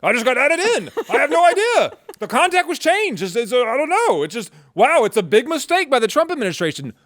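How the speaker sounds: high, put-on voice of a feigned innocent reporter; mock innocent journalist. Mocking the opposition journalist's act, in a high put-on voice. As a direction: play dumb in a high mocking voice.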